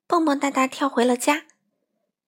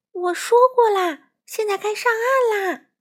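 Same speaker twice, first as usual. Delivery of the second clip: high, loud motherly order; insistent call; 11 semitones higher, 6 dB louder than usual. A narrator's reading. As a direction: call the child out firmly, pitched high. Mother insists child leave the pond. (妈妈高声催孩子上岸)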